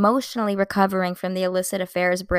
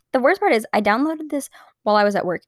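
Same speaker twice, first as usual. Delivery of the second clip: ordinary delivery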